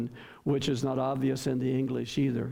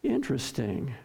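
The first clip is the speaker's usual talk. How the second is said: normal talking voice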